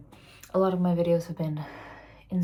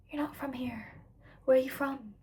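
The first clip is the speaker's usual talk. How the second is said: hushed, higher mimicked questioner; imitating questioners; 6 semitones higher, 3 dB quieter than usual. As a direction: lightly mimic a stranger singling someone out. Mimics people who single her out.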